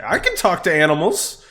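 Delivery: high, loud character voice; Xena speaking up. Acted. Game master as party member revealing a skill, piping up. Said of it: "Xena will say".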